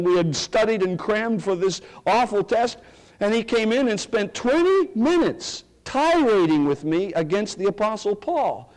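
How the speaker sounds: ordinary talk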